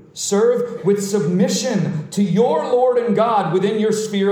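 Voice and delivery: forceful pulpit delivery with stretched key words; impassioned preaching. Real, not acted. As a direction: lay down a charge to rulers.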